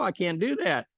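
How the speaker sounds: acted panic; whiny refusal. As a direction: repeat it like a scared child. Man keeps refusing.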